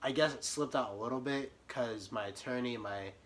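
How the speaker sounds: normal talking voice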